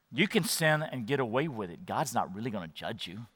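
very low, hushed and coaxing; quiet tempter in your ear; tempting inner voice. Acted. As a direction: play it down in a soft murmur. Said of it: "what it's gonna sound like in your ears".

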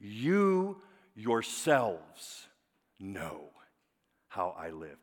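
slow, stressed on yourselves; emphatic slow reading. Shown as a demonstration. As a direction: lean hard on each word, slowly. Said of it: "it's very emphatic".

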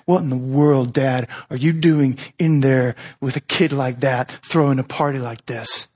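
grumbling. Retold or imitated. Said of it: "this grumbling of the older son".

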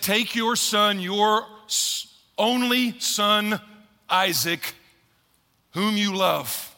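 slow, drawn-out words with pauses; slow, weighty reading. Shown as a demonstration. Pastor to congregation, God's command read slowly. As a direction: let each phrase land slowly. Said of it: "And he slows down".

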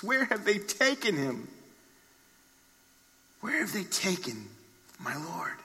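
acted grief, hushed and fading; soft grieving plea. Grieving Mary pleading for her Lord, hushed lament. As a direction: plead softly, letting the last words sink away.

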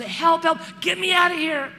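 loud, high acted panic; hammed-up terrified yell; panicked cry for help. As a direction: cry out as if trapped with lions. Clown falls into den of lions.

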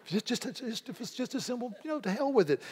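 high, laughing mimicry of a dry retort; mocking high repeat. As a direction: toss the line off high and amused. Mocking the quote, high pitched.

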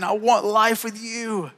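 slow, soft, tender plea; God speaking tenderly. Retold or imitated. God meeting you where you are, slow and tender. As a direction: lean in and say it slow and gentle. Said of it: "and saying".

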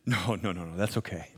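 polite refusal. Retold or imitated. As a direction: wave it off quietly, no fuss. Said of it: "the first response is".

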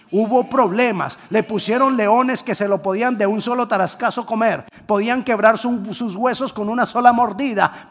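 high, loud preaching climax; fiery preaching. Acted. Preacher stirring the congregation, fiery preaching. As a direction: drive the point home.

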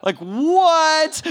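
loud, high, stretched-out shout; amazed. Real, not acted. Storyteller stunned by the radio miracle, amazed. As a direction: explode with disbelief.

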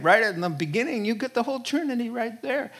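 ordinary delivery

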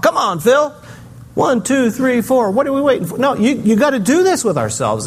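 high, peppy self-coaching voice, counting off like a coach; self pep talk. Retold or imitated. Psalmist giving himself a pep talk, hyped and high. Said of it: "his own little pep talk".

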